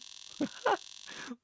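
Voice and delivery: high breathless mad cackle; evil cackle. Acted.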